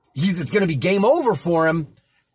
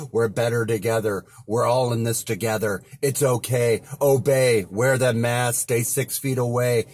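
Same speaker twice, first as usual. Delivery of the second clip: mocking the sheep; 8 semitones lower than usual. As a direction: mimic placid people repeating official lines. Mocking the calm, obedient public.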